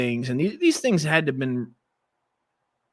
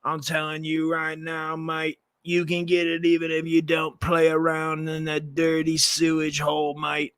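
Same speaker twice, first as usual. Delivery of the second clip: British bloke, slightly raised pitch; mock British accent; 3 semitones higher than usual. Host mimicking Brits people tune out, mock British accent. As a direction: lay on a cartoon British "mate". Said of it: "speaking gibberish and, and, and British".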